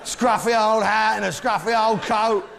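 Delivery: high-pitched, worked-up comic indignation; mock outrage. Comedian ranting to the audience about Peru's bears, mock-outraged.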